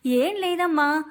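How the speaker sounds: ordinary delivery, nothing marked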